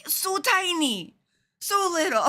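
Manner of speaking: high, slow, laughing squeak; amused mock disbelief. To the daughter over a tiny earwig, high laughing squeak. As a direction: squeal it, cracking up.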